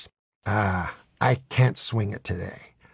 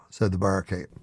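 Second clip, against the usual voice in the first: ordinary delivery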